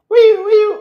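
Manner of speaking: very high, loud squeal; high loud mock squeal; squealed movie quote. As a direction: squeal it out like the film. Quoting movie sound.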